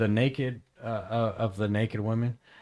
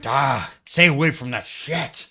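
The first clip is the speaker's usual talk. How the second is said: slow, raised scolding quote; higher, louder mock telling-off; retold warning; 4 semitones higher, 6 dB louder, slower than usual. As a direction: imitate him warning someone off. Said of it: "he was always say".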